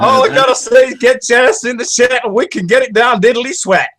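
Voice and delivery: high-pitched Southern cowboy yelp; Southern cowboy accent. Shown as a demonstration. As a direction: ham up a squeaky cowboy accent. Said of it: "wants me to speak like a Southern West cowboy".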